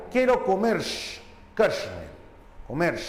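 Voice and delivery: Bolivian accent with hissed "sh"-like R in "comer" and "carne". Shown as a demonstration. Teacher shows how a Bolivian would say it, Bolivian hissed R. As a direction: imitate a Bolivian, R fricative as in "comersh, carshne". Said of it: "un boliviano, ¿cómo diga?".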